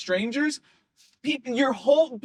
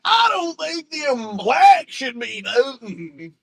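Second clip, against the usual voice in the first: put-on Southern accent; 4 semitones higher, 5 dB louder, much slower than usual. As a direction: drawl it slowly like a Southern bigot character. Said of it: "he says it in a Southern accent".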